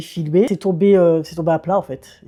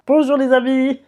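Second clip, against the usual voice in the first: higher, hammy southern cook voice; Maïté voice; 7 semitones higher than usual. Pretend. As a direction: put on a jolly Maïté greeting. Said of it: "je parle comme Maïté".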